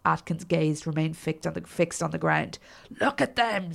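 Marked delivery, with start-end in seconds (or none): 3.0-3.7 screamed. A narrator's reading. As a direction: pitch it up like a restrained scream. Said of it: "screamed your man".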